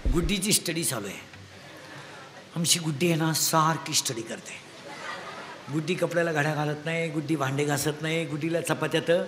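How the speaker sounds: low, soft parent's voice, dragging out the praise; mock a doting parent in a hushed low tone. Parent boasting to visitor that Guddi always studies, low and soft.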